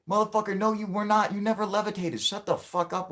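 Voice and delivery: high, heated rebuttal; furious, high-pitched no; angry high retort. Real, not acted. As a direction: shout him down.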